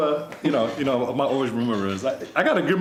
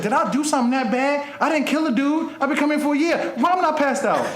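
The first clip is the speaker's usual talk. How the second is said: much higher, whiny pleading; high plaintive plea; 10 semitones higher than usual. Retold or imitated. Host retelling asking Jesus why he never fell out, whiny plea. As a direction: beg Jesus with mock despair.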